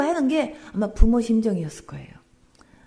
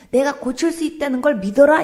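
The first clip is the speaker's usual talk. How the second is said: louder, firm command to believe; commanding Jesus; 3 semitones higher, 7 dB louder than usual. Retold or imitated. Pastor voicing Jesus' command to the father, loud and firm. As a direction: speak as Jesus, calling for faith. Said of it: "이거예요".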